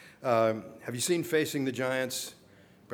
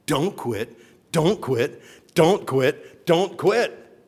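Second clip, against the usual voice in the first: building, louder and higher each time; preached exhortation; 6 semitones higher, 9 dB louder, much slower than usual. Real, not acted. Preacher urging listeners never to quit. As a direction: drive it home to the congregation. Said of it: "is keep going".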